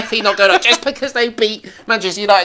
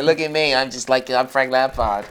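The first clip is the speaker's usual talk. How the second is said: put-on American accent; 6 semitones lower than usual. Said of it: "Why is he speaking American".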